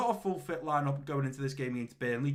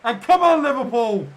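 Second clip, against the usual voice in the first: excited cheer; 6 semitones higher, 10 dB louder than usual. Real, not acted. Cheering his team at kickoff.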